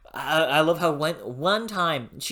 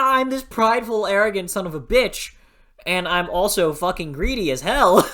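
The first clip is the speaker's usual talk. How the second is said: a light take on a proud, greedy dragon, higher and louder; arrogant dragon; 6 semitones higher, 6 dB louder than usual. Retold or imitated. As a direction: boast like a prideful dragon, half-laughing. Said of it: "In that, yeah".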